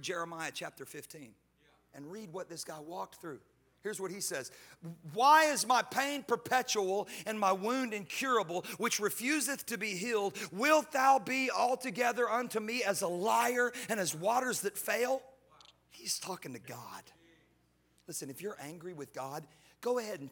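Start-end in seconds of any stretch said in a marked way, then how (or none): 5.1-15.2 dramatic scripture reading. Acted. Reading Jeremiah's lament aloud.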